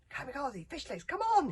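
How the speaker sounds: high, loud call to friends; shouting down. A narrator's reading. Hiccup shouting down to his friends, high shout. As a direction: call them up urgently. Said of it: "he shouted down".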